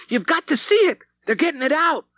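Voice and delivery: put-on high and loud boyish cry; excited yell. A narrator's reading. Boy calling his mother to come see, high and loud. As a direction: shout the news breathlessly.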